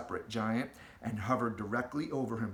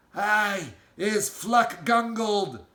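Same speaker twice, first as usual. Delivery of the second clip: slow, higher, loud scream; screaming giant; 7 semitones higher, 7 dB louder, much slower than usual. Acted. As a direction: scream in furious protest. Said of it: "screamed the maid masher".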